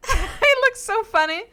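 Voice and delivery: squealing with laughter, high and loud; laughing hard. Real, not acted. Streamer cracking up at a game character's eyebrow, laughing hard. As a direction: crack up mid-sentence.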